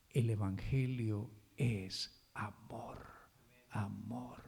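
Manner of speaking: slow, soft and low, dwelling on amor; gentle, slow "amor" said twice; tender. Acted. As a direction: let amor sink in, almost hushed. Tender climax of the sermon.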